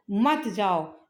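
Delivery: ordinary talk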